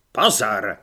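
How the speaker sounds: low, firm order with a falling end; drill command. A narrator's reading. Corporal calling recruits to attention, first command. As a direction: call them to attention, low and loud.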